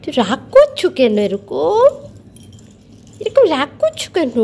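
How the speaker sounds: soothing a pet that won't cheer up; cooing at a sulking pet. Real, not acted. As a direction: gently ask the sulky bird what's wrong. Asking the sulking bird why.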